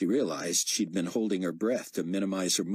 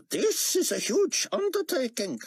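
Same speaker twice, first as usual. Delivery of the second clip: Chaim voice; 3 dB louder than usual. Acted.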